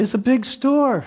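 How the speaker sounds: high whiny protest; whiny excuse. Retold or imitated. Shopper whining excuses to her conscience, high and pleading.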